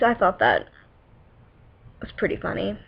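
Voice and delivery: ordinary talk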